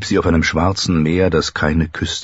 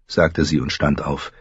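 ordinary delivery

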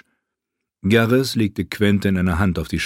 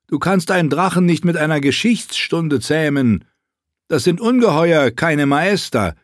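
mock the history lesson harshly; scoffing friend; 8 semitones higher than usual. Garrus scoffs at Quentin. (höher, spöttisch und laut)

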